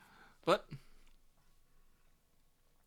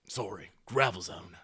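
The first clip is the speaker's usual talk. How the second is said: ordinary talk, nothing marked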